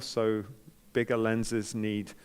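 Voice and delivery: ordinary delivery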